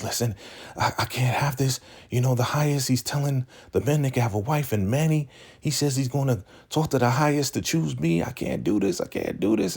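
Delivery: high-pitched retold panic; upset. Zane frets to Ruth about the wife plan, upset. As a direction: play someone worked up, pitch climbing. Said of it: "she is so upset".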